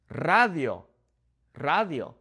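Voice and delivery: "Radio" with a rolled R at the start; Spanish "Radio" with a trilled R. Shown as a demonstration. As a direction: roll the first R of "Radio". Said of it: "Notice how I'm rolling that first singular R".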